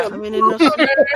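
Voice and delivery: high, very slow eunuch word. Pretend. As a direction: drawl it slowly and high. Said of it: "Então fala".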